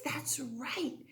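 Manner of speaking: soft whispered confirmation. Real, not acted. Teacher confirming to pupils, whispered. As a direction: whisper it like a secret.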